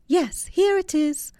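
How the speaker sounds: high, slow, timid machine voice; quivering AI voice. A narrator's reading. AI agent obeying the giant, high and quivering. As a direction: answer like a frightened little speaker. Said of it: "quivered the A I agent".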